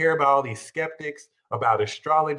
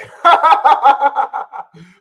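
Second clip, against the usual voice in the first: high, loud mocking laugh; 11 semitones higher, 11 dB louder than usual. Professionals mocking the magician with a loud laugh. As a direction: laugh at him like a skeptic. And they're like.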